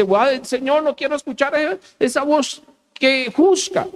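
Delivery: high, acted plea to escape; mock dread. People hiding from the Lord's thunderous voice, mock frightened.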